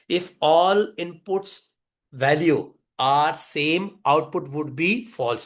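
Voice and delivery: very slow, spaced-out reciting of a rule; slow recital. Real, not acted. Teacher reminding class what the definition says, slow recital. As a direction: recite it word by word for learners. Definition क्या कहती है.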